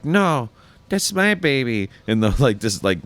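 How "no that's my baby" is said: slow, higher mock maid; Consuela voice. Friend retells the maid claiming Stewie, slow higher voice. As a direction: claim the baby, slow and high. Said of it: "And she's like".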